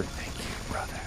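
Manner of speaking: hushed, solemn mock whisper. Whispering to fallen crumb on the chest, hushed whisper. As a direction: whisper it very softly. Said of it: "you whisper".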